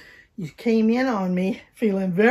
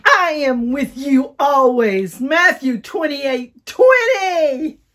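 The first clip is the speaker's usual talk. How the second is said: loud, higher, slow shouted verse; like we mean it; 4 semitones higher, 10 dB louder, slower than usual. Shown as a demonstration. Teacher urging children to mean the verse. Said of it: "Let's do it like we mean it".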